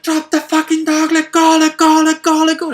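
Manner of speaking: high, very loud repeated yelling; father's desperate high shout at his son; retold frantic scream. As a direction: scream over and over to make him drop it. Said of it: "screaming at him".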